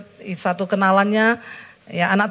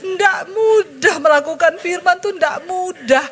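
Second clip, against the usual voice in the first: high, tearful confession; high, tearful confession to the congregation; crying; 8 semitones higher than usual. Real, not acted. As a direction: voice breaking as you admit it's hard. Emotional confession, crying heard.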